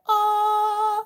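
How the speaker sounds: high sung angelic aah as the box opens; a high choir note from a magic box; heavenly choir ah. Retold or imitated. Mock heavenly ahh as the box opens.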